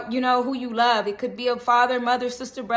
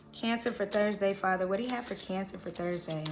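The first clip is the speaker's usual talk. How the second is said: much softer and lower, nearly murmured; hushed, softer plea asking Father about Cancer; hushed invocation; 3 semitones lower, 8 dB quieter than usual. Real, not acted. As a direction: drop to a private murmur to Father. Repeats call to Father, hushed.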